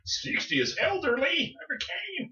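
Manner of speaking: put-on high, wailing protest at being called old; mock outrage. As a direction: whine it out in fake despair. Mock outrage over age joke.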